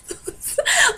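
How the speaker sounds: high giggling burst; sudden high-pitched laugh; burst of laughter. Real, not acted. Laughing at her own story.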